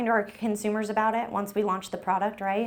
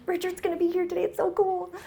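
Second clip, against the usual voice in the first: much higher mock fan voice; starstruck gushing; 12 semitones higher, faster than usual. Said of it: "It's like there's a celebrity".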